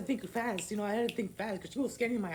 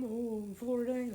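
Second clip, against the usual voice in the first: cashier's reply; 4 semitones higher, slower than usual. Retold or imitated. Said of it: "imitating cashier's answer".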